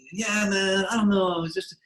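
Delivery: high-pitched laid-back shtick impression. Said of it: "his whole shtick was".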